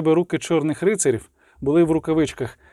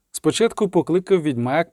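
ordinary delivery

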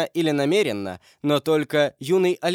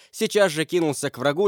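ordinary delivery

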